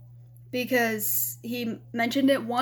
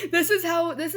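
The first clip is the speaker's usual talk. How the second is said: rueful laugh; 6 semitones higher, 4 dB louder than usual. Real, not acted. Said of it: "laughs at own bullying story".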